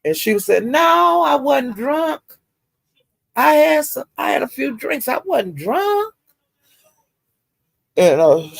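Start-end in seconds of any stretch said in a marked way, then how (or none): 0.7-6.1 high, protesting mock voice; defensive denial. Woman denying to Pizarro she was drunk, high protest. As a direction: insist you weren't drunk.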